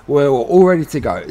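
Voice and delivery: ordinary talk, nothing marked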